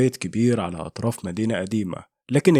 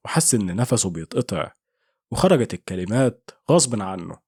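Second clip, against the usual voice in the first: usual speaking voice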